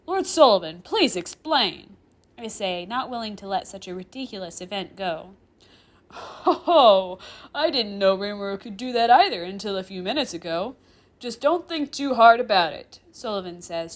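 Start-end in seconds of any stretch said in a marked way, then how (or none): 0.1-2.0 loud, drawn-out plea for answers; insistent. Acted. Professor unwilling to let it go, insistent. 6.1-13.0 put-on loud, jolly old chair demon; chuckling. Chair demon brushing off the professor, chuckling.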